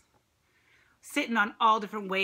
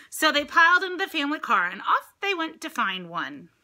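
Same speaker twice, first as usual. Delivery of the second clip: bright storybook reading voice; lively picture-book narration; read-aloud narration; 7 semitones higher, 8 dB louder than usual. A narrator's reading. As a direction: read it to the children with lift.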